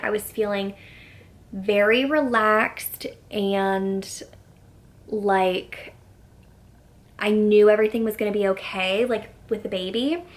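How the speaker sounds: ordinary talk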